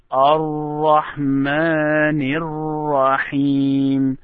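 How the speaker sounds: Ar-Rahmanir Rahim joined in one slow breath; joined recitation. Shown as a demonstration. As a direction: link Ar-Rahmanir straight into Rahim, slowly. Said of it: "So you start it this way".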